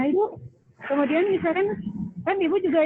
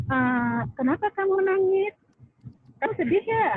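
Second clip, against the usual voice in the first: gentle counselor voice; 7 semitones higher than usual. Retold or imitated. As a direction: ask like a concerned counselor. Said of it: "si konselornya tuh kayak gini". (suara konselor yang lembut)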